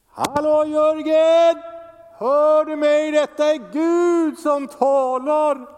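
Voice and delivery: hammed-up shout as if through a megaphone; megaphone God. Pastor parodies God calling him from far away, shouting. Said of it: "När behöver man en megafon?".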